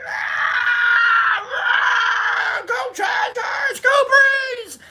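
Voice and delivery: high, stretched yell for the team; cheering yell. Retold or imitated. Lone Chargers fan cheering a good play, high yell. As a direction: stand up and yell for your team. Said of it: "I stood up and I was like".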